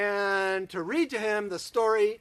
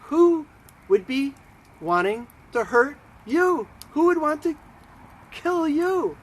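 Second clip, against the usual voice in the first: loud, high-pitched incredulous questions; outraged disbelief; 8 semitones higher, 8 dB louder than usual. Acted. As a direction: demand it loudly in halting, emphatic bursts. King shocked by threat to queen.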